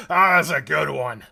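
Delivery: loud, hearty dwarf voice; jolly dwarf. Acted. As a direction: boom it out amused. Dwarf laughing with patrons.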